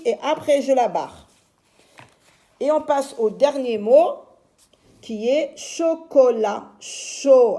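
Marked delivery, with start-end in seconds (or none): 5.6-6.7 "chocolat" slow, split cho, co, la; syllabes détachées. Shown as a demonstration. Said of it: "je vais lire d'abord les syllabes". (« chocolat » lentement, syllabe par syllabe)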